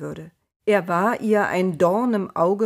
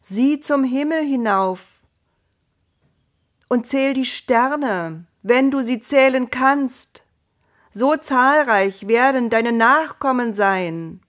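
higher, louder, slow solemn promise; raised, booming voice of God making a promise; divine voice; 6 semitones higher, 6 dB louder than usual. Acted. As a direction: speak as God from above, grand and certain. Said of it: "hörte er zum dritten Mal die Stimme".

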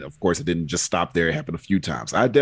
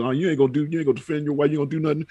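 higher-pitched imitation of hecklers; mock goading, pitched up; retold goading; 6 semitones higher, much faster than usual. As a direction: egg him on as the mocking crowd, lightly imitated. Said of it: "folks calling him a punk".

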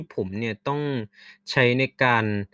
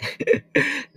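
short laugh; 14 semitones higher than usual. Real, not acted. Laughs at own story.

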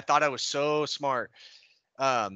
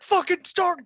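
much higher barked order; 13 semitones higher, 4 dB louder, slower than usual. Retold or imitated. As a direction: snap the command. Sergeant orders exercise.

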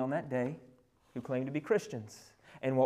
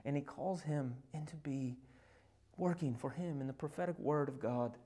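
soft preaching; 4 dB quieter than usual. Acted. Preacher speaking of intimacy with God, soft preaching. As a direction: draw them in close and hushed.

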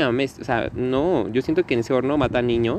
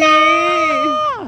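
put-on high, loud child's scream of "No"; scared child shrieking at mother's threat, full scream; 6 dB louder than usual. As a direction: shriek it like a terrified kid. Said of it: "Ay, grité, ¿verdad?".

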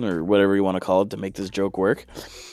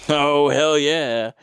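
higher, louder, stretched out hell yeah; drawn-out delighted hell yeah; slow gleeful oh yeah; 6 semitones higher, 5 dB louder, much slower than usual. Pretend. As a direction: savor it like pure bliss. Reacting to imagined service, drawn out.

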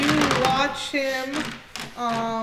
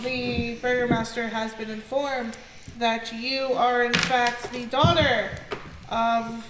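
usual speaking voice

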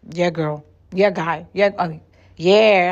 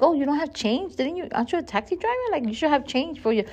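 mock indignant; 7 semitones higher, 5 dB quieter than usual. Mock scolding the driver.

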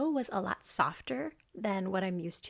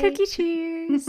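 cheery toast; 9 semitones higher, 8 dB louder, slower than usual. Real, not acted. Toasting with cookies.

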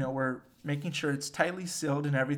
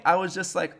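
ordinary delivery

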